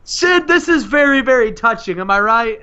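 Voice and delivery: high, mocking heel voice; hammed-up sarcastic wrestler; Lex Luger. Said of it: "Lex Luger and Sid Vicious".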